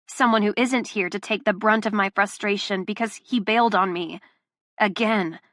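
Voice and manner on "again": plain reading voice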